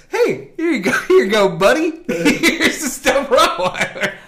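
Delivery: laughing mock EMT. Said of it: "the dude just like".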